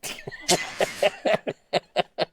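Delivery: breathy helpless laughter; wheezing, high laughter at the clogs joke; real laugh. Real, not acted. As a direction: crack up at the pun.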